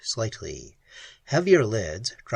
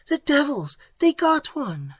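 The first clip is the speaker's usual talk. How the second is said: high, horrified outcry; 13 semitones higher than usual. A narrator's reading. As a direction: cry out in shock at the killing. Pat horrified at the killing.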